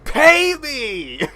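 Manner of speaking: high, loud battle cry; full-throated demanding shout; triumphant yell. Retold or imitated. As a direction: bellow it as you drive the blade home. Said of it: "and yell".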